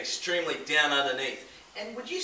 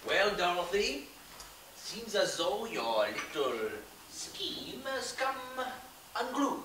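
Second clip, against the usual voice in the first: put-on French accent, slow and sly; a con man playing a Frenchman, French accent throughout; fake French accent; 5 dB quieter, slower than usual. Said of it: "That doesn't sound very French, Pierre".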